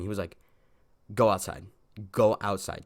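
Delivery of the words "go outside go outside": louder mock teacher's command; retold stern order. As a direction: imitate a teacher firmly ordering a pupil out. Teacher ordering student out of class.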